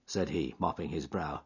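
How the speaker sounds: ordinary delivery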